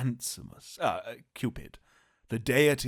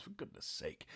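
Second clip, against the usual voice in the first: exasperated whisper; 17 dB quieter than usual. Real, not acted. Exasperated aside.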